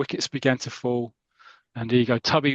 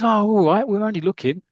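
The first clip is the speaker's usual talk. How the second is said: higher-pitched mock protest; protesting schoolboys; 8 semitones higher, 5 dB louder than usual. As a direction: answer back like a told-off lad. Boys answering back to master.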